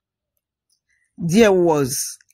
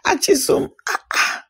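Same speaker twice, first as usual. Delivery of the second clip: surprised gasp; 11 semitones higher than usual. A narrator's reading. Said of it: "was surprised to hear that".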